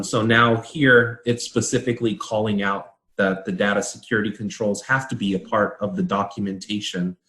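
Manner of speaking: ordinary talk, nothing marked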